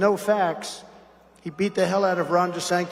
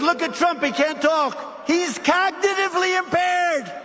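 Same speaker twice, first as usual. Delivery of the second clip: mocking the press; 9 semitones higher than usual. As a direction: ham up the reporters' gloating. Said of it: "and they said".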